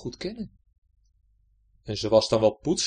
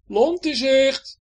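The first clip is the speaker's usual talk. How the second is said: high, loud, drawn-out call, slow; calling from above; 12 semitones higher, 9 dB louder, much slower than usual. Acted. Hendrik calling from the mast to Betje, loud. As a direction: sing out the sighting. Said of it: "Hendrik van boven riep".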